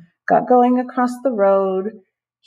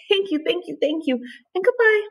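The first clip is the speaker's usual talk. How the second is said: high ant voices; 12 semitones higher than usual. Acted. Ants say goodbye.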